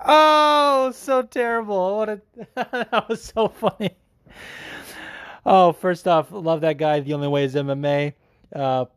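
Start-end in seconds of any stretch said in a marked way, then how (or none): 0.1-4.0 high, drawn-out laughing groan; cracking up. Real, not acted. Host reacting to a listener's terrible impression, cracking up. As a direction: laugh helplessly through the words.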